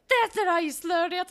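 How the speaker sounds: loud, high put-on character voice; mock scolding. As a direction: butt in like a meddling chaperone. Character barging in to scold dancers.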